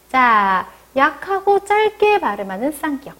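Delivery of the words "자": ordinary delivery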